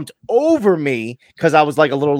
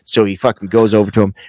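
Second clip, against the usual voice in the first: normal talking voice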